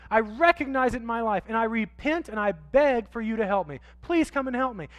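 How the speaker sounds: loud, high confessing plea; impassioned confession. Pretend. Pastor voicing a sinner confessing to God, loud plea. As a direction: cry it out to God, pleading.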